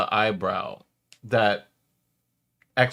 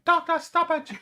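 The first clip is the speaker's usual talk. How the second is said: very high squeaky imitation yell; mock yelling. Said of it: "on the side of the cage yelling".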